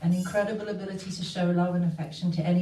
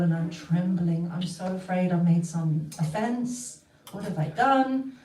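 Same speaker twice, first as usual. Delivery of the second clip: ordinary delivery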